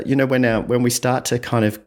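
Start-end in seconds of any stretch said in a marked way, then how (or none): none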